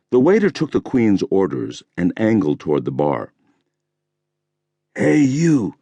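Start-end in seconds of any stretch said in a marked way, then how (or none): 4.9-5.7 called out. A narrator's reading. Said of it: "Debro called".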